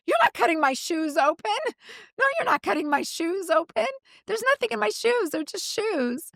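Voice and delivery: high, pleading mock outrage; retold refusal, insistent and high; indignant protest. Retold protest to customs agent.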